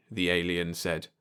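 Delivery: ordinary delivery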